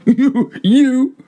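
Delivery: very high incredulous squeak; stunned, pitched right up; drunken disbelief. A narrator's reading. Fortunato doubting Montresor is a Mason, high squeak.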